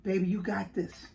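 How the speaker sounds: sarcastic imitation of a useless partner; mocking husband. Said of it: "standing and telling".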